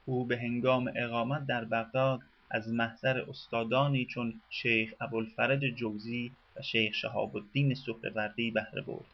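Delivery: normal talking voice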